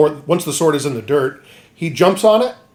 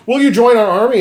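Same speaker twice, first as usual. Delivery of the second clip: mock eager recruiter; 9 semitones higher, 5 dB louder, faster than usual. Retelling anime, guy recruiting Guts.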